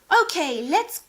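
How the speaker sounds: loud, high "let's go"; rally everyone for launch; excited announcement. Acted. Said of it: "Sheila announced".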